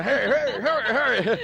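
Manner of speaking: high, hurried mock boss voice; mock bossy director. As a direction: play a pushy director rushing himself. Acting out arguing with himself.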